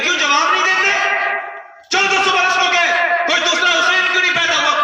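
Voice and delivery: high, loud demand for an answer; challenging shout. Retold or imitated. Official challenging the silent crowd, loud shouted demand. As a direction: hurl the question at the silent crowd. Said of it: "کہنے لگے".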